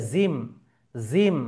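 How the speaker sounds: জিম said as "zeem", with a buzzing z; wrong z sound. Shown as a demonstration. Teacher warns of a mistake, জিম mispronounced as zeem. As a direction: begin জিম with z in place of j. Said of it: "তাহলে ওটা ভুল উচ্চারণ".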